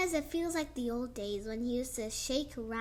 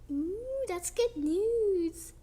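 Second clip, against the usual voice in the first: pleased "ooh", slow and higher; delighted; 5 semitones higher, much slower than usual. Real, not acted. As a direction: glad reaction to good news. Delighted at Pop's decision.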